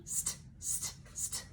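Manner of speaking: "st" hissed unvoiced, very soft; soft whispered consonant drill; whispered phonics sound. Shown as a demonstration. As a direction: breathe out the "st" sound with no voice. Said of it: "repeat after me".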